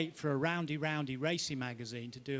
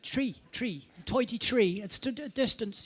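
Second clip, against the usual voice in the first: imitated Irish co-driver, higher and more insistent on tidy tree; Irish co-driver impression; 8 semitones higher, 6 dB louder than usual. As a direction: repeat the Irish pace note, pushier, higher. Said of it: "No, he said".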